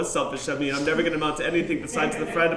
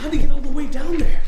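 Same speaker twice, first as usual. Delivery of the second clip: high, loud amazed shout; astonished shout; 10 semitones higher, 11 dB louder than usual. Acted. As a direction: shout it in disbelief.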